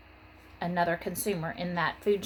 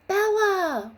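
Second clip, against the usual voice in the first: high, loud cry of the frog's name; wailing; 11 semitones higher, 7 dB louder than usual. Acted. Wanda wailing for her escaped bullfrog, high and loud. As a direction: wail it out after the lost frog. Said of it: "wailed Wanda".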